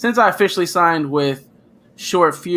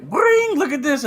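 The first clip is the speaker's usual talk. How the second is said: imitating excited friend; 11 semitones higher than usual. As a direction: squeal "Bling" very high, then brag to your buddy. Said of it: "you're like".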